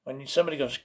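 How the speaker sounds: normal talking voice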